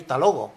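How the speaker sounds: everyday "hasta luego", no "has", no diphthong; reduced form. Shown as a demonstration. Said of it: "Yo no puedo escribir".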